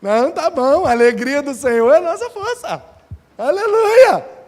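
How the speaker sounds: high, hasty mock fright; frightened people. The frightened people quickly praise God to avoid punishment. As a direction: hurriedly back down in hammed-up panic. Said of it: "o povo assustou e falou".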